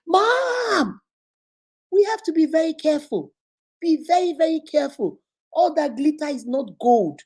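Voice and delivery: high, pleading son's voice that cries out at first; a boy calling out to his mother, alarmed; son's urgent warning. Retold or imitated. Son warns mother on live.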